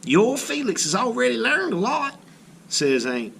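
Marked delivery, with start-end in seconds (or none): 0.0-2.2 high, amazed exclamation. Acted. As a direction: marvel at how much he learned. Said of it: "in amazement".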